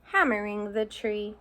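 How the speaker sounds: ordinary delivery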